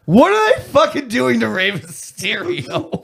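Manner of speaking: high-pitched, incredulous outburst; retold disbelief. Wrestling fan turning to a friend over the stunt, acted disbelief. Acts out own shocked reaction, retold.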